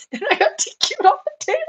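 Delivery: high-pitched, through real laughter; laughing. Real, not acted. Exhibitor telling of a disqualification, laughing. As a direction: let the laugh carry the words up high.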